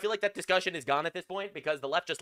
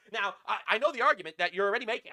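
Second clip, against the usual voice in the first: normal talking voice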